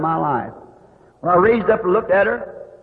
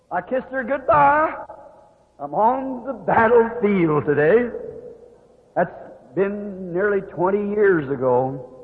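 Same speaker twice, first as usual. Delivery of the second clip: ordinary delivery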